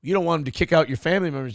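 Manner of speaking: ordinary talk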